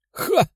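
high sharp fighting shout; sharp exhale. A narrator's reading. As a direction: kiai as she strikes. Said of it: "выдохнула рыжеволосая".

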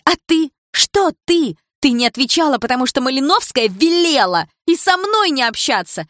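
high, loud accusing tirade; furious reproach. A narrator's reading. Dilara shouting at her former friend during the fight, furious. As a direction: hurl every betrayal at her.

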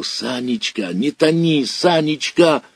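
plead, frightened, to hold on; desperate pleading. Acted. Boy begs his drowning friend, frightened plea.

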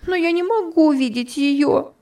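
Topsy cries out over Eva's body; anguished cry. A narrator's reading. High anguished cry. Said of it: "вскричала Топси".

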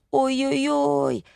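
high, drawn-out moan of dismay; keen it slowly, up high; lamenting. A narrator's reading. Wife repeating her dismay to husband, lamenting. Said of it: "повторяла Зоя".

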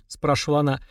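ordinary delivery

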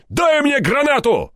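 high, slow shouted demand; yelling. A narrator's reading. Степаныч bluffs the gunman about grenades, yelling. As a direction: bellow the bluff at high pitch. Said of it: "заорал Степаныч".

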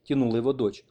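ordinary delivery

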